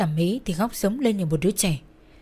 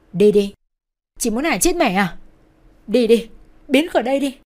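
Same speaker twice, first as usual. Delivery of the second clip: loud, raised angry shout; angry yelling; 3 semitones higher, 7 dB louder than usual. A narrator's reading. Brother pushing sister from mother's bed, yelling. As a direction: yell to drive her away. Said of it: "nó gào lên".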